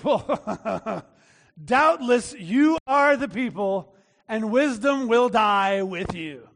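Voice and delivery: slow, drawn-out sarcastic praise after a scoffing laugh; mock their wisdom with exaggerated deference; heavy sarcasm. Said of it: "with a heavy dose of sarcasm".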